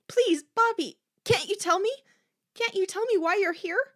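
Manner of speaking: high, loud, desperate begging; imploring, voice pushed way up; pleading. A narrator's reading. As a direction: beg her for an answer. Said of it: "Corky pleaded".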